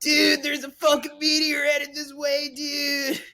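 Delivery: high, slow panicked astronaut radio; breaking up space radio. Retold or imitated. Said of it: "my impression of an astronaut".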